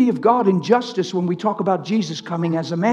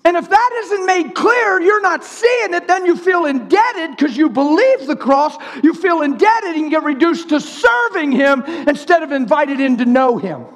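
high, driving sermon voice; fervent preaching; 7 semitones higher than usual. Acted. Preacher warning believers who feel indebted, fervent preaching. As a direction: push through it high and forcefully.

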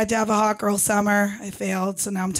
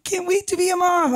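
put-on high-pitched squealing baby fever; exaggerated squeal, voice shooting up; mock freak-out; 10 semitones higher than usual. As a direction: gush hysterically like a baby-crazy friend. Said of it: "they freak out".

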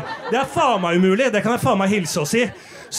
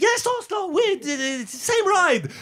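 high eager pleading in broken English; mock Romanian English; 9 semitones higher, slower than usual. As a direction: imitate the pickers begging to come along. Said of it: "Og så peker de". (høy, ivrig, gebrokken engelsk)

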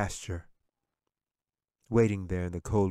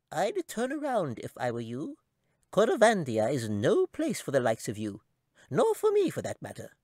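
high squeaky voice, grim warning; tiny creature cautioning a stranger; squeaky chipmunk warning; 7 semitones higher than usual. A narrator's reading. Chipmunk warns Katy.

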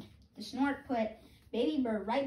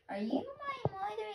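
high, small chick voice, lost and asking; a baby bird piping for its mother; 12 semitones higher than usual. Acted. Hatchling searching for its mother, high piping voice. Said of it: "my baby bird voice".

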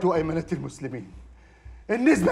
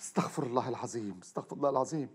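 soft, low murmured prayer; pious mutter; 6 semitones lower, 11 dB quieter than usual. Acted. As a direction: mutter it like a man wronged. Feigned piety after scene. (تمتمة ورعة بصوت خافت)

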